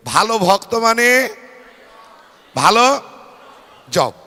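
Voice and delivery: loud call-and-response line; mantra call. Shown as a demonstration. Preacher repeating the mantra with the congregation, loud call. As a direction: lead the chant loudly. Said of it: "আর একবার মন্ত্রটা বলি নাকি?".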